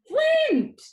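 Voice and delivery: high, loud yell of a name; shrill call. Acted. Grandma calling her grandson from down the hall, shrill and loud. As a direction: call him sharply from down the hall. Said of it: "but not now".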